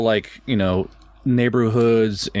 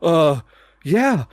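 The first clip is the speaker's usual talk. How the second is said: unsure, high-pitched mock clerk; a hint of an awkward hotel clerk; hesitant clerk; 5 semitones higher than usual. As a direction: clerk unsure how to answer a bloody guest. Hesitant clerk answering bloody guest.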